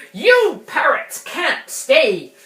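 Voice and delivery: pirate voice. Shown as a demonstration. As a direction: boom it out like a pirate captain. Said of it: "in proper English first".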